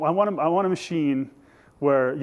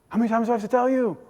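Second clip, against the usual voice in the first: high, rapid exasperated question; 8 semitones higher, much faster than usual. Acted. Healed man to Pharisees who keep asking, exasperated. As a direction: rush it out, fed up with repeating yourself.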